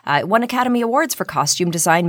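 usual speaking voice

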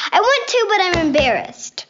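high, loud, embarrassed protest. Real, not acted. Daughter defending her kisses to dad, protesting. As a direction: burst out high, then slide back down.

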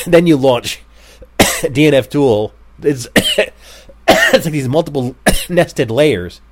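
talking through coughs; words broken by coughing; coughing fit. Real, not acted. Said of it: "I'm coughing so much. I have covid".

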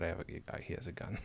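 hushed, breathy whisper, haltingly; whispered secret. Pretend. Host passing on what Michael whispered, in a hushed whisper. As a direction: whisper it under your breath. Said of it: "What did he just whisper to you".

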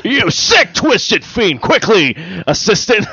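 high, hammed-up villain voice; mock villain. Riffing on movie voices, hammy villain calling his assistant. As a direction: ham it up as a cartoon villain.

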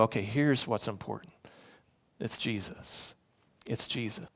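soft, low, reverent self-reminder; hushed realization. Acted. Preacher models looking past holiday stuff to Jesus, hushed realization. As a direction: drop low and soft, letting it settle. Models quietly seeing what really matters.

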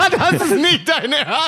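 high, slow, laughing in disbelief. Real, not acted. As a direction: laugh it out, can't believe it. Reacting to the open-window showers.

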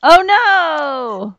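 loud, high wail of mock horror; dramatic dismay. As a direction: cry out in exaggerated dismay. Mock dismay at missing ham.

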